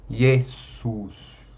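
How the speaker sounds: "Jesus" as "ye-sus" with a long voiceless S; unvoiced S. Shown as a demonstration. Teacher repeating the model, "Jesus" with voiceless S. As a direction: say "Jesus" like two S's in the middle. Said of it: "Dos dois S's".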